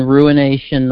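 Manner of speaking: ordinary talk, nothing marked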